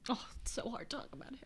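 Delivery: breathless whisper through tears; barely able to get it out; real tearful whisper; choked whisper. Real, not acted. Tearful tribute to her mentor.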